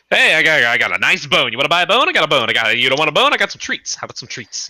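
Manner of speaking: rapid-fire shady salesman pup; greasy pup peddler. Acted. Greasy pup hawking bones and treats, pushy peddler voice. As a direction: keep pushing the goods, voice jumping high. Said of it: "and he's just like".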